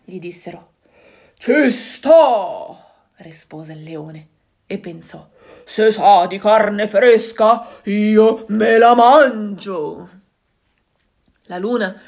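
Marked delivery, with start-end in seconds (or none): 1.4-2.9 loud, put-on lion growl; a bold lion accepting. Lion accepts the animals' request, in a loud lion voice. 5.7-10.1 loud, slow lion roar. Acted. Lion plots to eat the moon, in a slow loud roar. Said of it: "udendo il leone ruggire".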